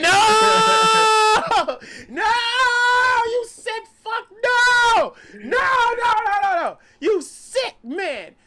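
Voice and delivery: high, loud, cracking yells of denial; anguished screaming. Retold or imitated. Fan retelling how he screamed at the TV. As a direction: scream no at the screen as if in pain. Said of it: "I said".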